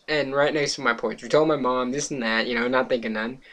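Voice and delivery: ordinary talk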